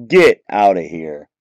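low, stunned get outta here; awed, low get outta here; disbelief. Real, not acted. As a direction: drop the voice in amazed disbelief. Reacting to the big pull.

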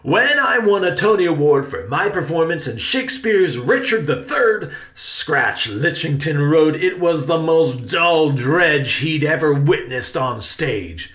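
loud, high, declaiming outrage; grand theatrical grievance; indignant rant. Acted. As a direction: protest like a wounded stage star. Uncle rants about bad review.

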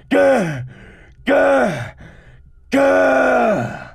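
short high, loud cries of frustration; frustrated yells. Real, not acted. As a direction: let out repeated "gah" bursts in exasperation. Frustration outburst after rant.

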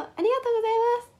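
high, fast, sugary idol cutesy voice; fake cute pouty voice. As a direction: bat your lashes and gush the thanks. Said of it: "ぶりっ子で言ってください". (ぶりっ子声)

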